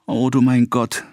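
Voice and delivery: understated lament; sighed exclamation. A narrator's reading. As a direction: sigh it out with a touch of despair. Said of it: "seufzte Emma".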